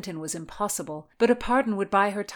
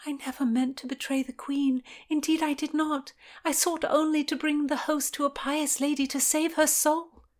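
put-on higher, earnest young woman; young lady voice; 9 semitones higher than usual. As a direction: plead as a frightened young lady. Constance voiced, higher pitch.

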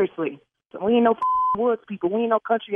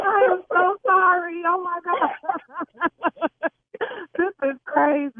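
laughing relief; 7 semitones higher than usual. Real, not acted. Prank revealed, caller laughs.

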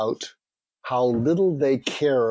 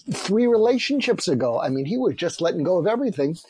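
ordinary talk, nothing marked

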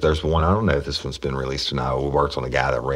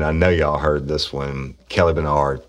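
normal talking voice

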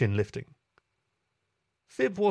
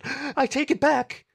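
high, loud, slow scared outburst; jolted by thunder, take it back; frightened cry; 14 semitones higher, 4 dB louder, slower than usual. A narrator's reading. Thunder boom frightens Meadow.

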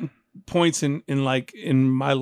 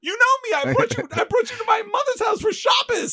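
frantic pleading. Retold or imitated. Young rapper begging his date not to leave. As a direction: plead frantically, voice cracking high. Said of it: "I was like".

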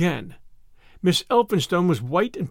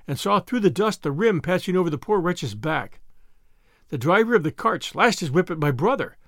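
usual speaking voice

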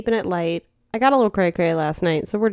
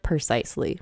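deadpan; 5 semitones lower, 8 dB quieter than usual. Retold or imitated. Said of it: "I was like".

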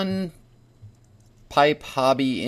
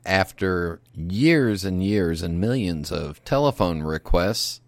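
hijacked broadcast; 4 semitones lower than usual. Acted. Mock news bulletin interrupting show.

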